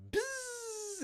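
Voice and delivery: high-pitched buzzing reel noise; imitated reel buzz. Angler mimicking the reel taking off, high buzz. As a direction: buzz like line ripping off a reel.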